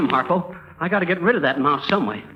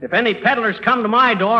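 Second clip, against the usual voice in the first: usual speaking voice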